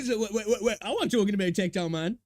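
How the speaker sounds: loud, high, laughing through the words; laughing protest. Real, not acted. Host joking he meant someone else, laughing loudly. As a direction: protest while still laughing.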